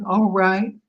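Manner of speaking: high, offhand all right; breezy, unbothered reply; nonchalant. Retold or imitated. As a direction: toss it off like it's nothing. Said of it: "All nonchalantly".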